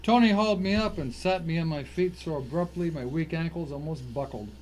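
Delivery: normal talking voice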